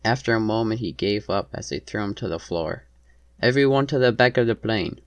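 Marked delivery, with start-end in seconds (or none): 3.4-5.0 higher, louder command; raised-voice hijacker's command; shouted order. A narrator's reading. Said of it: "shouted in a thick accent".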